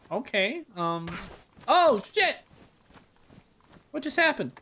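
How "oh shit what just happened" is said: high, loud sudden shock; real alarm at the game; startled. Real, not acted. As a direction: jump at what just happened.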